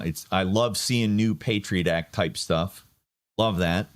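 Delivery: ordinary talk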